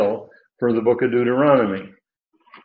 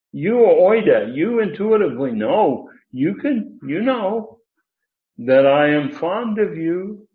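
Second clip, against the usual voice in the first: raised pitch, punching each "You"; emphatic YOU; 6 semitones higher, 4 dB louder than usual. Acted. Preacher as Peter insisting the Lord knows, emphatic YOU. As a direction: insist on "you", high and pressing. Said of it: "He's using that emphatic pronoun again".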